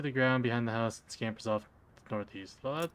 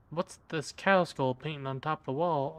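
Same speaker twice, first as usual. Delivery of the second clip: ordinary talk, nothing marked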